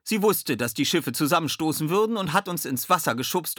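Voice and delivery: high, delighted voice; beaming joy. A narrator's reading. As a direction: gush happily about your rescuer. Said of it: "mischte sich Sally freudestrahlend ein".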